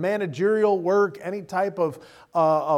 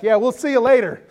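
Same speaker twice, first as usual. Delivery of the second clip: breezy mock farewell; 6 semitones higher, 7 dB louder than usual. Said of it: "be like".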